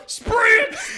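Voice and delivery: high, loud laughing yelp; laughing shout. Real, not acted. Laughing at his own punchline, high shout. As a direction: blurt it out mid-laugh.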